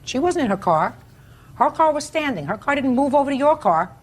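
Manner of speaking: high-pitched, sharp rebuke; stern lecture. Real, not acted. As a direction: lecture him firmly. Judge lecturing the defendant.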